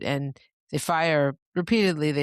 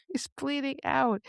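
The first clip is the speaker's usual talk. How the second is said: horrified emphasis; 9 semitones higher than usual. Shown as a demonstration. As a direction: repeat it in a high, hushed, horrified voice. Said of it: "And then he's bleeding out".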